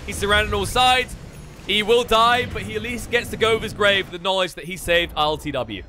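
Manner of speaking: lively esports commentary; play-by-play. Acted. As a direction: call the fight as it unfolds. Casts live play-by-play.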